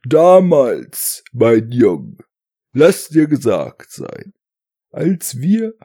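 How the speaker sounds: lightly voiced old veteran reminiscing; alter Mann erzählt vom Krieg. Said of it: "wie bei alten Männern, die aus dem Krieg erzählen". (alter Kriegsveteran, leicht gespielt)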